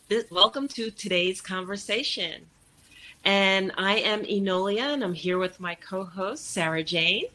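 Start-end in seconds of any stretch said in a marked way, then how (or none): none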